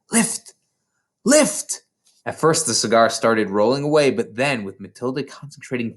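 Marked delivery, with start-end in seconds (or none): 0.1-1.8 will it upward, building; urgent command. Acted. Matilda urging the cigar up.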